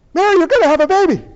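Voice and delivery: high, rapid-fire announcement; imagined Gabriel. Acted. Gabriel gives Mary the baby news, in a hurried high blurt. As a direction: blurt it out at double speed, loudly. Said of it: "you imagine Gabriel, he's like".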